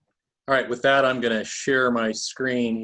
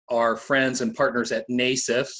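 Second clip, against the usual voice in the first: normal talking voice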